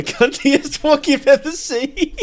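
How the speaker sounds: high, loud words through real laughter; cracking up mid-sentence, voice jumping up; laughing. Real, not acted. Laughing while describing guards.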